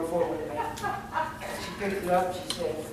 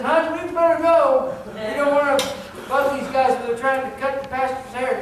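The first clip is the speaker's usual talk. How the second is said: husband's loud urging; 6 semitones higher, 7 dB louder than usual. Retold or imitated. Husband quoted urging wife out.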